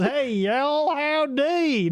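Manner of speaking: high, hammed-up Southern howdy; exaggerated Southern greeting; mock Southern twang. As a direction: put on a silly cowboy drawl. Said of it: "No one goes".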